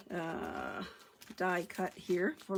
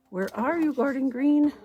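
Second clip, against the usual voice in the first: higher, louder sing-song call to a hidden ink pad; playful calling out; 6 semitones higher, 9 dB louder than usual. Pretend. Crafter calling to a lost ink pad, playfully raised call. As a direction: coax the ink pad out of hiding, teasing.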